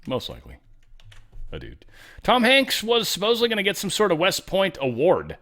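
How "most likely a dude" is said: muttered deadpan repeat of most likely a dude; deadpan quiet repeat. Real, not acted. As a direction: lower and softer, a dry deadpan aside. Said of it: "but I'm just gonna say".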